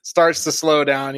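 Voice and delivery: ordinary talk, nothing marked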